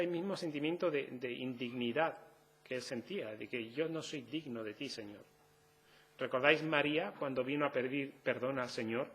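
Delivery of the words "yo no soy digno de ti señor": humble plea. Acted.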